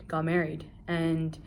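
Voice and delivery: usual speaking voice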